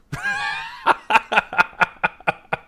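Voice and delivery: loud, high staccato cackle; staccato laugh. Real, not acted. Game master after the dwarf's joke, high cackle. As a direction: burst into a long, pulsing high laugh.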